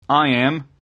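firm call up to the deck; calling up from below. A narrator's reading. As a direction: announce yourself from over the side. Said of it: "a voice came up from the side".

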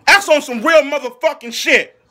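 ordinary delivery

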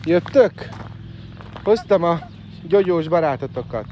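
loud, high animal call; loud sing-out greeting to pets. Real, not acted. Calling out to animals.

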